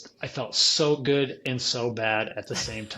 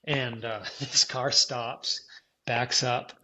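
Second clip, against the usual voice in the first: ordinary delivery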